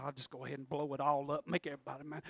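low and soft, quick; muttered aside. Retold or imitated. Preacher voices someone giving up on the family, muttering. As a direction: mumble it to yourself. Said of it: "sitting there going".

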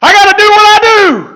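high, loud, slow hallelujah burst; joyful shout. Pretend. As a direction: shout it like the baby leaping for joy. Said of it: "and he goes".

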